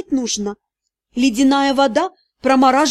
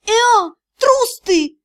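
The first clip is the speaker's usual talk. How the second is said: high, accusing cry; despairing shout; 8 semitones higher, 3 dB louder, much slower than usual. A narrator's reading. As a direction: yell in a burst of despair. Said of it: "И в порыве отчаяния я закричала". (крик в порыве отчаяния)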